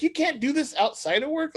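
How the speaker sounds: high-pitched mock reprimand; high, bossy telling-off; scolding boss. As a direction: imitate a boss laying down the rule. Voices boss scolding him.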